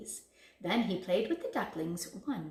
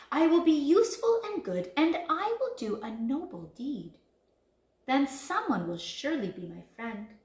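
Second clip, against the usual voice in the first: higher, louder, lightly emphatic resolve; eager resolve; 6 semitones higher, 7 dB louder than usual. A narrator's reading. Big Bad Wolf deciding to do a noble deed, eager resolve. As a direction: announce a good plan with some gusto.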